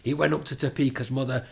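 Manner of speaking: plain reading voice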